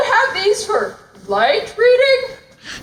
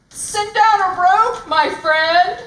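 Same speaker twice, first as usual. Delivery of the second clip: normal talking voice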